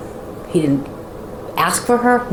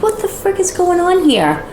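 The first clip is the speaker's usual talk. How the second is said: high incredulous; 13 semitones higher than usual. Retold or imitated.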